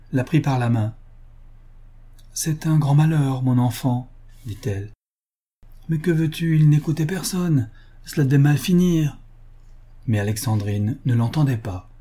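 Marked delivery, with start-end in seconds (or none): 2.3-9.2 lightly voiced older lady, higher; older lady consoling. A narrator's reading. Family friend consoling the daughter, mildly higher older voice. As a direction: console her with cool resignation.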